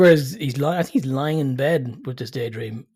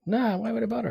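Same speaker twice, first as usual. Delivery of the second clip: higher, offhand shrug of a line; dismissive; 8 semitones higher, 5 dB quieter than usual. Retold or imitated. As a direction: toss it away like it doesn't matter. Voicing careless boyfriend.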